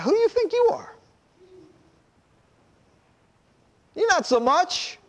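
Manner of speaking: high, scoffing challenge to the leader; mocking defiance. Grumbling people sneer high at Moses. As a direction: sneer it up high like a rebellious crowd.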